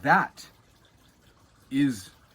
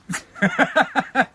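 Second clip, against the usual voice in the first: laugh; 10 semitones higher, 6 dB louder than usual. Real, not acted. Laughs at own joke.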